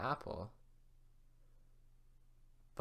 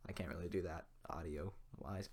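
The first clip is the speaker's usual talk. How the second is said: whisper; 8 semitones lower, 10 dB quieter than usual. Real, not acted.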